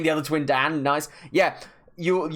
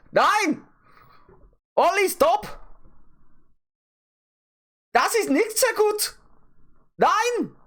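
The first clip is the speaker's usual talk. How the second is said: very high, hammed-up German accent; comic falsetto German voice; slower than usual. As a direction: squeal it in mock German. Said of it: "do my German accent".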